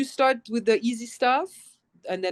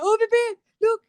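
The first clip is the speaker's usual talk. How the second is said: excited to husband; 11 semitones higher, faster than usual. Retold or imitated. Telling husband while he plays the game, thrilled and laughing. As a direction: gush proudly to your husband. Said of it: "and I'm like".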